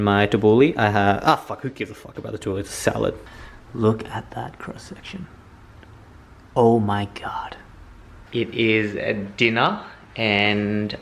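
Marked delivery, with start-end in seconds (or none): 3.7-7.6 awed whisper. Real, not acted.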